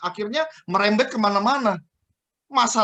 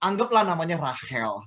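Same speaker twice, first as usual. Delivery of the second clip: normal talking voice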